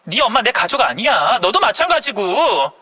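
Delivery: fed-up wife cutting him down; contemptuous wife. Acted. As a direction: berate the husband with scornful questions. Wife berates husband.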